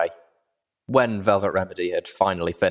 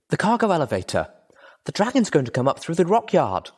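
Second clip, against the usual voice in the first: higher and urgent, the penny drops; alarmed realisation; 10 semitones higher than usual. Acted. Wounded pony warns her friend, alarmed. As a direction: blurt out the danger as it hits you. Sudden realisation of dragon danger.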